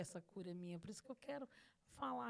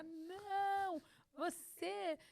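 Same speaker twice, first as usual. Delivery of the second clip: high, loud, slowed protest; retold screaming at God; 5 semitones higher, 4 dB louder than usual. Woman reenacting her shouting at God, loud and high. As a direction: hurl the complaint upward, almost yelling. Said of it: "Aí eu gritava".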